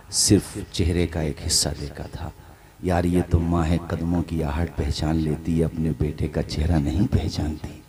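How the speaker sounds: low and soft, almost breathless suspense; hushed storytelling. A narrator's reading. (धीमी, दबी हुई कथा-वाचन की आवाज़)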